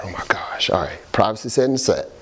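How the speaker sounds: whispered embarrassed aside. Retold or imitated. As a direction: hush it like a secret aside. Hushed aside about privacy.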